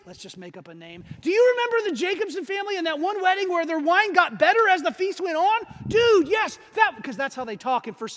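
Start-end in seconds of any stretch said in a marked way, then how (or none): 1.2-5.6 high, loud, eager reminiscing; excited retelling. Acted. Villagers retelling the wedding story. 5.9-6.9 high, loud burst of enthusiasm; excited agreement. Acted. Villager replies about the wedding.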